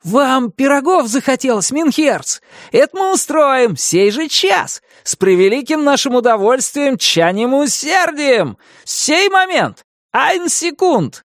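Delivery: high eager babble, words tumbling; rapid patter. A narrator's reading. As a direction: fire it off like a servant. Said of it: "скороговоркой выпалил Меньшиков". (скороговоркой)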